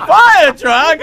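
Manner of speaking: high, loud, delighted; thrilled at the thought of a fire truck; mock excited passenger; excited question. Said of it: "He is excited".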